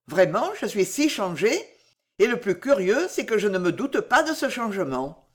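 usual speaking voice

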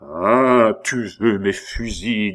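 loud opening "Ah", then controlled threat; menacing taunt. A narrator's reading. Abbé has Pitou cornered, menacing taunt. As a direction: taunt him with rising anger.

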